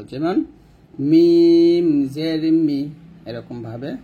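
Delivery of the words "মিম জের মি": মিম said loudly, lips pressed on the dry part; মি closed on the dry part of both lips; demonstrated Arabic meem. Shown as a demonstration. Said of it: "দুই ঠোঁটের শুকনো অংশে মিল করে আমরা মিম উচ্চারণ করব".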